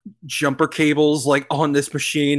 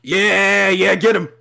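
cheering shout; 4 semitones higher, slower than usual. Real, not acted. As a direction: urge him on. Cheering the fight.

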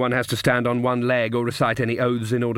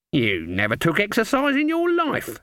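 put-on higher, tart retort from an old witch; 9 semitones higher than usual. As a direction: snap it back at your old friend. Granny Weatherwax retorts.

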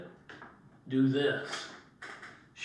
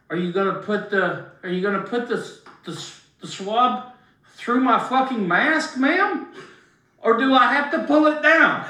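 mock-polite sarcasm, building louder to ma'am; sarcastic; 5 semitones higher, 3 dB louder than usual. Boxer retelling how he mocked the nurse, sarcastic and rising. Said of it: "I said".

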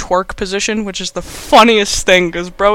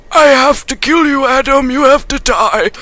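high, loud, hammed-up anguish; mock desperate wail; 6 semitones higher, 5 dB louder than usual. Bleeding doctor tells Adam he must die, hammed-up anguish. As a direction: play a bleeding man's desperate cry. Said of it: "'cause he's, like, you know, severely losing blood".

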